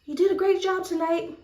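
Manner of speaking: high, eager fan compliment; excited fan praising a star, high and eager; starstruck gush. Retold or imitated. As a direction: gush at your idol. Fan gushing at [name].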